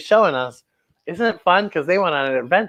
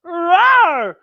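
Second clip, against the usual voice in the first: monster roar; 10 semitones higher than usual. Acted. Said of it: "And Buffy says".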